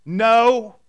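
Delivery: loud, huffy brush-off; grumpy fishermen. Retold or imitated. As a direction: snap back like sulky fishermen who caught nothing. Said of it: "as loving fishermen, they say".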